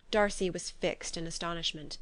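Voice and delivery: usual speaking voice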